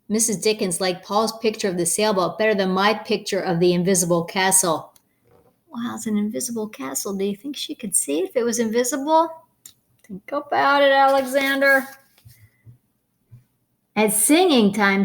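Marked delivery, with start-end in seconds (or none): none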